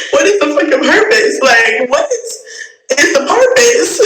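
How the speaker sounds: exasperated high-pitched laughing question; incredulous laughing climb. Real, not acted. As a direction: pitch shooting way up into a disbelieving laugh. Exasperated rant at harasser.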